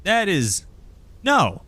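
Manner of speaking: high-pitched objector from the crowd; woman piping up. Acted. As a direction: protest emphatically from the back. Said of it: "pipes and goes".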